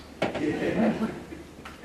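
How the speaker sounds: breathy laugh. Real, not acted. Amused at the name joke.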